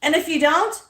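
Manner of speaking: sudden shout of no with a cry in it; loud yell. Acted. Singing along in the car, she suddenly shouts loud. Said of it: "that was my ear".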